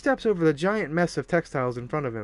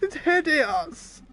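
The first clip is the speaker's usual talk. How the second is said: slow high theatrical voice; 11 semitones higher, much slower than usual. Acted. Mock voice gasped reaction.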